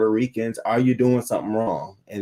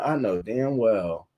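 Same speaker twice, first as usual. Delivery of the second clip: usual speaking voice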